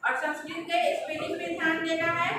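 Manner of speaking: ordinary delivery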